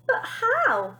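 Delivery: very high, pleading question; helpless mother pleading. Acted. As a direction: plead for help. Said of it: "pleaded Mother".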